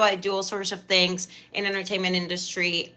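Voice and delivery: ordinary talk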